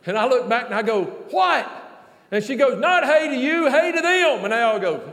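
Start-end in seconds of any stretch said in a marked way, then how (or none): 1.3-1.7 high, loud one-word retort; exasperated holler back. Retold or imitated. Preacher answers Vanessa's repeated greeting, loud puzzled retort. 2.8-4.4 high, hollered scolding imitation; loud correction. Preacher voices Vanessa correcting him, loud high scolding.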